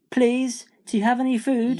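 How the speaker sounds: put-on high, polite young Jack; high-pitched storybook plea; pleading boy. A narrator's reading. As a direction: ask up hopefully, in a small boy's voice. Jack begs giantess for food.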